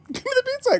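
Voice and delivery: fast giggling demand; laughing through it. Real, not acted. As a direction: break into laughter mid-line.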